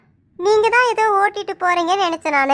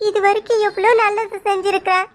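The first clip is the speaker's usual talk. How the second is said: usual speaking voice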